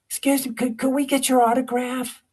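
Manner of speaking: put-on high, sweet girly voice; teen girls asking. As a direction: ask politely like a star-struck teenager. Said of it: "three high school girls".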